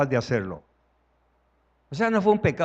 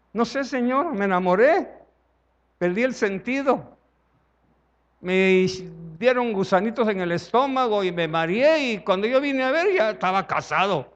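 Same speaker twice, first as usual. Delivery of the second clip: lovesick excuse; 5 semitones higher than usual. Acted. Pecador enamorado se excusa, comedia.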